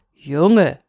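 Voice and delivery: low, firm, drawn-out address; admonishing old moon. A narrator's reading. Moon halts the restless boy, admonishing. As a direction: call a boy to order in the moon's low tone.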